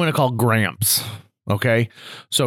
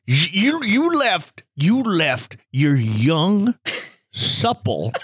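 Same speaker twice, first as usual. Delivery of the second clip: put-on scandalized old-timer, drawn out; imitated old man in slow disbelief; Gramps' voice; 5 semitones higher, slower than usual. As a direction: linger on each word like an appalled grandpa. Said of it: "Gramps Grant's talking like this".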